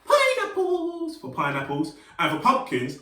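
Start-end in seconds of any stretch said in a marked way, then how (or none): 0.1-1.2 very high, slow and stretched; high silly fruit voice for kids; squeaky high pineapple voice. Shown as a demonstration. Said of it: "a voice for the pineapple".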